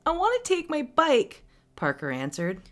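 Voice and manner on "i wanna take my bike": put-on high, loud small-child whine; little kid's protest. Little brother wanting his bike, high loud protest. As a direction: whine it like a stubborn little boy.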